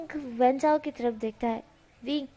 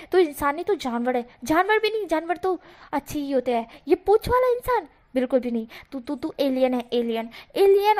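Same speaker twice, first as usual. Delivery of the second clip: angry rant; 3 semitones higher than usual. Acted. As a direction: tear into him with rising fury. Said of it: "वांग जी गुस्से में बोलता है".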